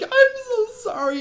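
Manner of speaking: high, crying wail of sorry; mock crying apology. Dreamer apologizing as the witch kills everyone, high sobbing wail. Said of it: "And I'm like".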